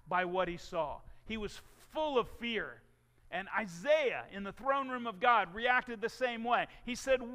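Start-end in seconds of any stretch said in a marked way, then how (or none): none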